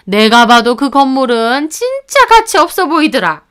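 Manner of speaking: indignant, high-pitched in-law dismissing the purchase; scolding mother-in-law. Acted. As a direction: scoff like a disapproving mother-in-law. Mother-in-law scolds, sides with husband.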